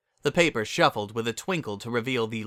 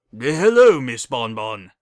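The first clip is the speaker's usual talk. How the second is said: stern stallion voice; 9 dB louder, slower than usual. A narrator's reading. Stallion greets coldly.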